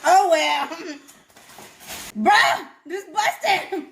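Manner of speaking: high, laughing through words. Real, not acted. Laughing at her own busted moment. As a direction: giggle as you say it.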